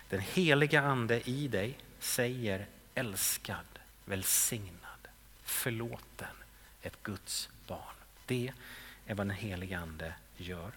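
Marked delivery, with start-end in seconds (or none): 0.1-7.9 hushed, unvoiced, lower and much softer; whisper. Preacher whispering the Holy Spirit's voice to the congregation. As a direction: breathe each word like a secret blessing. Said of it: "den här rösten vill jag lyssna efter".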